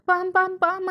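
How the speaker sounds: high, loud yelled noise; yelling. Acted. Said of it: "అని అరుస్తారు".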